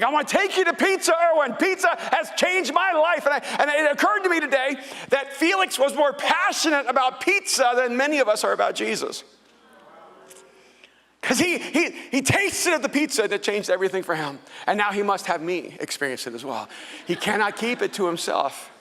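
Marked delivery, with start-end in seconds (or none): 0.0-3.2 laughing impression of an eager friend; imitating Felix. 3.3-14.4 high, loud excited preaching; laughing, fired-up storytelling; preaching at full force. Acted.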